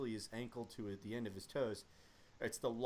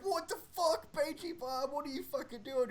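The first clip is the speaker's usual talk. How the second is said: mock alarmed shout, higher; alarmed squadmates; 14 semitones higher, 4 dB louder than usual. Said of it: "And they're like".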